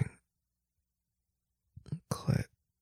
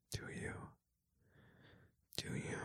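fading whispered repeat; barely audible; 30 dB quieter, faster than usual. Acted. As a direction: let the question die to breath. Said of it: "repeated question fading".

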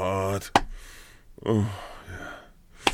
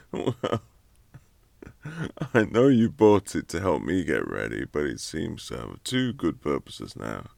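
ordinary talk, nothing marked

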